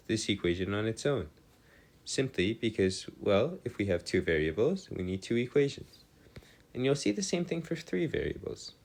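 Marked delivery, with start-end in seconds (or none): none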